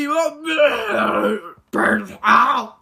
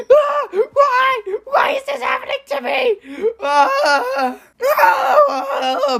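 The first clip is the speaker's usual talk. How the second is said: high-pitched laughing lament; hammed-up despair breaking into laughter; mock wail; 13 semitones higher, slower than usual. Mock despair at bird attack.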